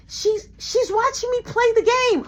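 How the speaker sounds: put-on high insistent kid voice; kid brother voice. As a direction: play the stubborn little boy.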